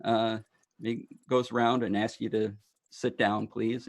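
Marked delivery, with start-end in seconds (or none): none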